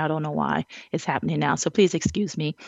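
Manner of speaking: ordinary delivery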